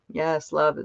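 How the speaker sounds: ordinary delivery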